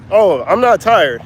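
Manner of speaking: normal talking voice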